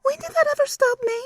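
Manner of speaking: very high, otherworldly leer; very high eerie giggling demon. Acted. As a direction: purr it with a nasty giggle. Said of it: "nauseating giggle".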